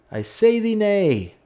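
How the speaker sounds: high, loud, slow olde-English decree; mock archaic proclamation. Gamer mock-forbidding the tractor like a herald, loud and slow.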